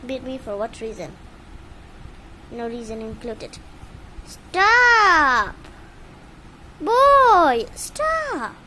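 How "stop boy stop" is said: shouted stop. Real, not acted. Owner shouting at her frustrated bird, loud. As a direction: snap at the bird to quit.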